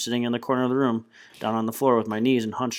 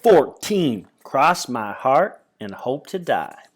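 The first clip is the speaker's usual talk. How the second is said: slow, louder chapter heading; chapter title read; 3 semitones higher, 6 dB louder, much slower than usual. A narrator's reading. Narrator announcing the chapter title, slow and emphatic. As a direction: announce the new chapter slowly.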